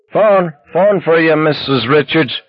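high-pitched call down the hall; distant yelling. A narrator's reading. Neighbor calling Sheila to the hall phone, mock yelling. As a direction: call out high, without full volume. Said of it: "heard the unfamiliar voice knocking and yelling".

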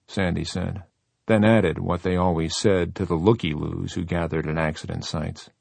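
ordinary delivery

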